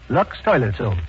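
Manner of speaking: ordinary talk, nothing marked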